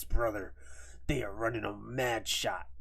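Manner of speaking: a light Hogan voice drifting into Macho Man; quiet half-hearted Hogan growl; a hint of Hogan calling everyone brother; Hulk Hogan impression. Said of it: "I don't know why he sounds like the Macho Man".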